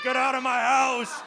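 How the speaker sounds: put-on slow, high shouted old man; hammed-up cranky old man; angry old man. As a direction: bark like an old man disturbed in the shower. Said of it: "He's like".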